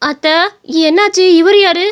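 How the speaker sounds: high-pitched, confused questions turning to complaint; bewildered daughter-in-law. Acted. Woman wakes confused and in pain. (குழப்பத்தோடு வலியில் பேசும் மருமகள்)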